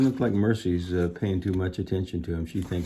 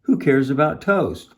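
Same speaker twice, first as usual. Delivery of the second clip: higher, louder dismissive retort; irritated grumble; 8 semitones higher, 8 dB louder than usual. A narrator's reading. Eugenia scorning the toast request, irritated grumble. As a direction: throw it back with annoyance. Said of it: "grumbled Eugenia".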